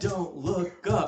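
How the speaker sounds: slow, loud, dramatic warning; loud dramatic quote. Retold or imitated. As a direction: boom out the warning slowly. Repeats the quote louder.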